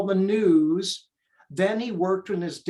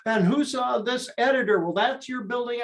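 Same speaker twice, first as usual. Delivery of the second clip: higher-pitched imitation of calling out to kids; retold yell, raised in pitch; mock yell; 6 semitones higher, faster than usual. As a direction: imitate a tour guide hollering, pitched up without much extra volume. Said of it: "he'd yell at the kids".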